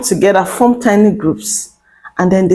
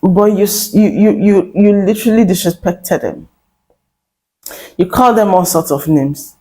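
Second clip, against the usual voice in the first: ordinary talk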